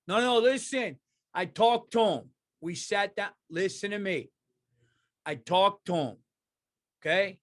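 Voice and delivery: mock-earnest Italian talking everyone down; Italian mob guy. Italian guy vouching for the zombie he talked to, insistent. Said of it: "Italians are trying to feed the zombies".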